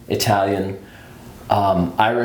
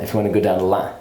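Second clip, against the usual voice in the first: usual speaking voice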